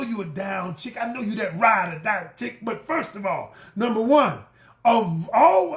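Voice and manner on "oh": ordinary delivery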